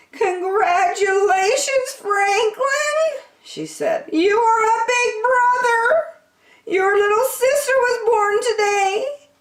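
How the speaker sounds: put-on happy tears for the new baby; joyful granny. Granny announcing the birth to Franklin, tearful joy.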